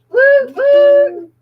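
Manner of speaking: high, loud celebratory woo; excited cheer. Real, not acted. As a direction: cheer the winner on. Cheering a quiz winner.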